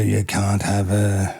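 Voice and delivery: drawn out slowly, teasing denial; slow refusal. Retold or imitated. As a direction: stretch it out, a firm no. Retold refusal.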